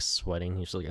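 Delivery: ordinary talk, nothing marked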